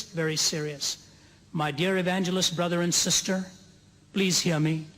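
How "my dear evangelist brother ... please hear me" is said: ordinary talk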